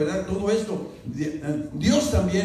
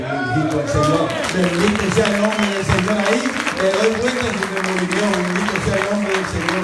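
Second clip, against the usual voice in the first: ecstatic praise; 2 semitones higher, 6 dB louder than usual. Acted. Preacher praising God before the congregation, loud ecstatic blessing. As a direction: lift the praise up to the rafters.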